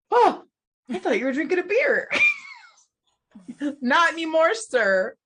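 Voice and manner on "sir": ordinary talk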